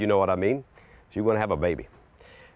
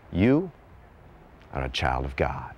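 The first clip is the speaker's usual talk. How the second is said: gentle reminder; 5 semitones lower than usual. Shown as a demonstration. Preacher voicing grace to the listeners, gently. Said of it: "gentle reminder in the voice of grace".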